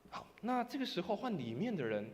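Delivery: normal talking voice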